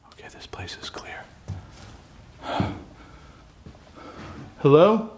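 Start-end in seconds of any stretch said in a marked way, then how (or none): 0.1-1.2 hushed whisper of nerves; tense whisper. Real, not acted. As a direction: whisper so nothing hears you. Checking room while frightened.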